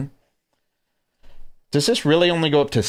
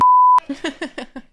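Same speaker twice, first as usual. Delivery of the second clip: sudden high burst of laughter; big laugh. Real, not acted. Laughing at his cringe impression.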